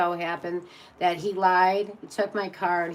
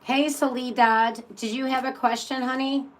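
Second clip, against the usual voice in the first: calling out; 5 semitones higher, 3 dB louder than usual. Real, not acted. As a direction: call out to her across the room, sweetly. Calling out to a chat viewer.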